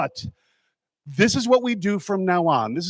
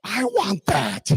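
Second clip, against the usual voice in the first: longing cry; 7 semitones higher, much slower than usual. Retold or imitated. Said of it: "I'm like".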